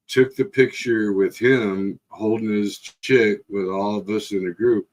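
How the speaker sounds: ordinary talk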